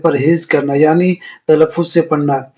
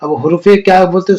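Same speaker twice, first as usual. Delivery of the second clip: ordinary delivery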